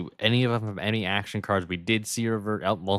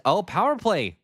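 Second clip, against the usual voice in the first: sudden jump up in pitch, a bit louder; surprised call; 9 semitones higher, 4 dB louder than usual. Real, not acted. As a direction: catch the play as it happens, caught off guard. Surprised commentator spots power play.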